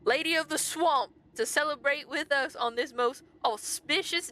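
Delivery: pompous proclamation. Acted. Guard welcoming the Lady of the Swamp, pompous proclamation. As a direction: proclaim it grandly, with full pomp. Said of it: "Louder and with more pomp".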